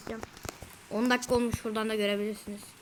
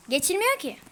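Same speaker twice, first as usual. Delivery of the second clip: higher, louder exasperated cry; frustrated outburst; 6 semitones higher, 6 dB louder than usual. Real, not acted. Gamer yelling at a level that won't let him pass, frustrated outburst. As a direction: blurt it out, fed up with the jump.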